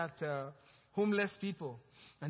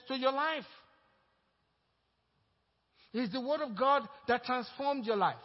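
loud, high, rising preacher's cadence; full-force preaching; 6 semitones higher, 5 dB louder than usual. Acted. Pastor exhorting the congregation, full-force preaching. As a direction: build the sermon to a climax.

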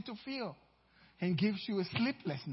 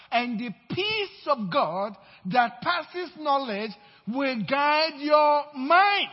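slow, louder pulpit proclamation; preaching scripture; 4 semitones higher, 7 dB louder, slower than usual. Acted. Pastor declaring scripture to the congregation, slow and louder. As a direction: lean into each phrase like a preacher.

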